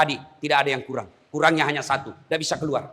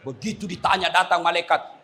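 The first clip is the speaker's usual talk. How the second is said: usual speaking voice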